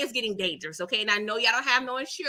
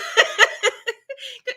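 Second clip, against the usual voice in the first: high laugh; 13 semitones higher, 4 dB louder than usual. Real, not acted. Laugh alone.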